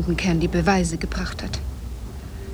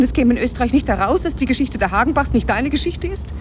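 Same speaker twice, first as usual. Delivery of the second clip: ordinary delivery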